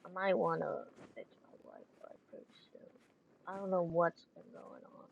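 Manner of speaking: ordinary delivery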